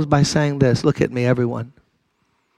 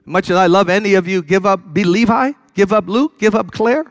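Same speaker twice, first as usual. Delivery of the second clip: higher, pleading preacher's voice; impassioned preaching; 7 semitones higher than usual. Acted. Preacher urging listeners to give their lives to Christ, impassioned. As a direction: plead with them to turn.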